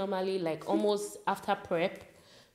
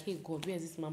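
quiet inner thought; 3 semitones lower, 12 dB quieter, faster than usual. Retold or imitated. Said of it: "my mind was like".

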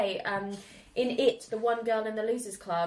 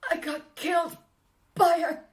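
higher, louder outraged villain; mocking movie line; 8 semitones higher, 6 dB louder, much slower than usual. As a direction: ham up the wounded pride. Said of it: "without the line".

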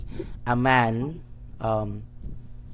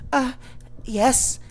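put-on higher nervous girl voice; a crying kitchen girl greeting strangers, higher timid voice; 11 semitones higher than usual. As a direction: play a frightened young kitchen helper.

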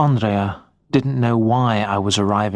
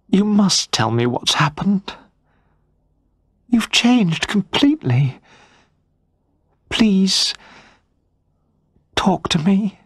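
higher pleading wife's voice, rising at the end; 9 semitones higher than usual. Acted. Wife begging her silent husband to talk, pleading. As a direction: beg him gently to open up.

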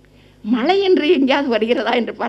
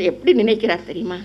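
ordinary talk, nothing marked

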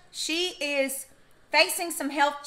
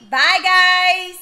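loud bye, pitched up; shouting goodbye; 6 semitones higher, 11 dB louder than usual. Real, not acted. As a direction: call out bye guys. Calling goodbye to viewers.

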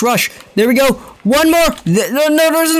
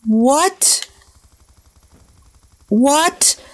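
ordinary delivery

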